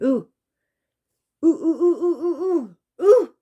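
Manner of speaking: high, loud, frightened ooh ooh ooh; frightened ooh cries. Retold or imitated. Retelling the ghost encounter to viewers, loud frightened oohs. Retelling terrified reaction to spirit.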